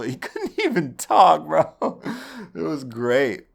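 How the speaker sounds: high, loud and laughing through the words; gleeful laugh while telling it. Real, not acted. Laughs recounting the beating.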